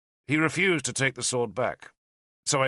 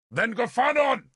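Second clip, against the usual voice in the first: high, loud summoning shout; 13 semitones higher, 5 dB louder than usual. A narrator's reading. Arthur summons a god from the cliff, shouting. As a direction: call the god out over the sea wind. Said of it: "he shouted into the sea wind".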